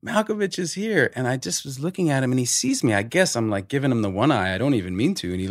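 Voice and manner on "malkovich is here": much higher, awestruck crew gasp; excited crew. Retold or imitated. Crew buzzing that Malkovich has arrived. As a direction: blurt it out like a starstruck crew. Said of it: "the crew's like".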